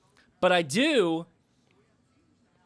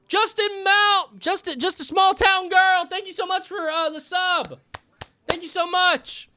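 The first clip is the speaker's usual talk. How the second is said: high, hyped-up gratitude; excited shout-out; 11 semitones higher, 5 dB louder than usual. Real, not acted. Streamer thanks Justin for the sub, excited shout-out. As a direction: belt out the name, then gush thanks.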